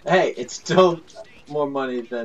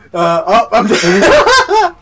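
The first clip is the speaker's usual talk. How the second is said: high, loud shout breaking into laughter; laughing yell; 8 semitones higher, 11 dB louder, much slower than usual. Real, not acted. Player dying in a bike game, laughing yell. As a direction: crack up while yelling it.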